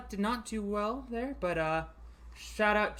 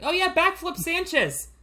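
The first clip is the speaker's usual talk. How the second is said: excited outburst; 9 semitones higher, 6 dB louder than usual. Real, not acted. Sudden idea, blurts out name.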